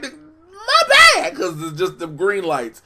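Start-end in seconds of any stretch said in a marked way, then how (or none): 0.0-1.4 very high, loud shrieking mother voice; Martin's mama voice. Retold or imitated. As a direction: shriek it like a frantic sitcom mom. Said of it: "made Lynn sound like Martin's mama".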